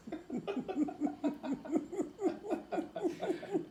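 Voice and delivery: high breathy burst of laughter; laughing. Real, not acted. Telling friends the rat story, a real laugh. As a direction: crack up laughing mid-story.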